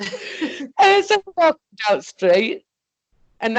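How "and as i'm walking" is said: laughing. Real, not acted. Telling a friend about the walk to the co-op, giggling. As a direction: still high and giggly, words bubbling out. Laughing retelling, still high.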